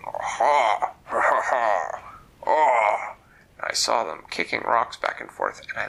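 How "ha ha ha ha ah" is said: ogre laugh. Acted. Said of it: "ogre voices saying".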